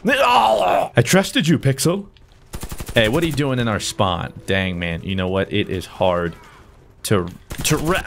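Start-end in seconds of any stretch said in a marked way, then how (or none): 0.1-2.1 betrayed. Real, not acted. Medic let him die.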